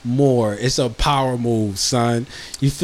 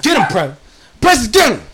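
higher and louder, urging the dog; calling the dog; 8 semitones higher, 4 dB louder than usual. Real, not acted. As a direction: sic the dog on him, loudly.

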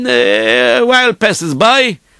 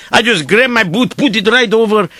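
ordinary delivery

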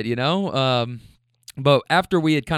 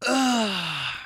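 higher, drawn-out effort groan; straining grunt; 5 semitones higher, 4 dB quieter than usual. Retold or imitated. Heavy man reaching for car door, imitated straining grunt.

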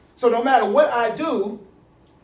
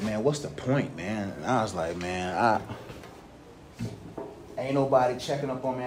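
low despairing mutter; 8 semitones lower, 9 dB quieter than usual. Retold or imitated. Retold despair, quiet.

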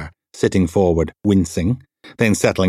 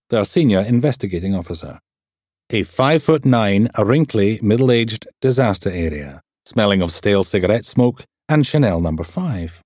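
plain reading voice

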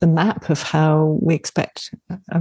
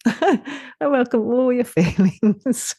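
high, slow, lightly sung tender phrase; tender mantra; 6 semitones higher, slower than usual. Retold or imitated. Lightly imitating herself telling her mum, tender and slow. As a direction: coo it lovingly.

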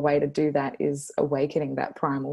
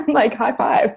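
laughing; 9 semitones higher, 8 dB louder than usual. Real, not acted. Laughing while cheering.

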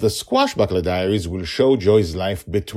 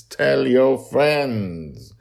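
slow emphatic plug; 5 semitones higher, 5 dB louder, much slower than usual. Acted.

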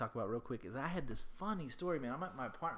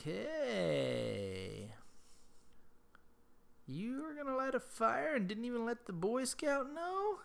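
mock neighbour; 11 semitones higher, slower than usual. Imitating neighbour Hudson's boast.

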